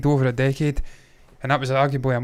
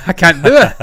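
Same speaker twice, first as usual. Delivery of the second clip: laughing; 3 semitones higher, 9 dB louder than usual. Real, not acted. Co-host failing at the Geordie accent, laughing. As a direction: give up through laughter.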